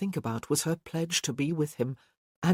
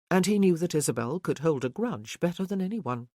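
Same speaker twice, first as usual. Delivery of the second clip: ordinary talk, nothing marked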